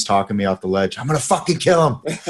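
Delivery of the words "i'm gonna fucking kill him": high, hammed-up threat; mock-furious outburst on the edge of laughter; mock rage. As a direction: imitate blowing your top, pitched way up. Retells own rage at teammate.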